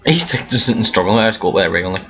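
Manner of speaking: usual speaking voice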